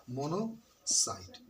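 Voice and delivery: "Monocyte" slowly in two parts, mono, pause, site; syllable-split dictation. Shown as a demonstration. Teacher dictating to pupils, slow split "monocyte". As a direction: break "monocyte" after mono, drawing it out.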